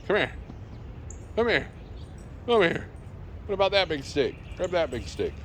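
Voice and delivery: pet voice. Real, not acted. Owner teasing the dog about the stick off the fence, high pet voice.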